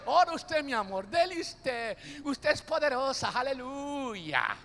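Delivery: soft, sweet-talking husband; lightly voiced husband pleading softly; coaxing husband. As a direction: coax her gently to pray for you. Husband coaxing wife to pray.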